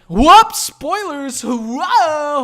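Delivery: high, loud mock-alarmed whooping; exaggerated oops, shouted high. Mock alarm at a spoiler slip.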